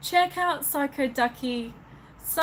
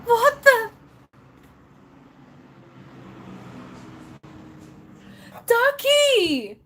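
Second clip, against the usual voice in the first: stunned shock; 10 semitones higher, slower than usual. Real, not acted. Live streamer reacting to Ducky's gift, stunned. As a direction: gasp it out, stunned, pitch leaping up.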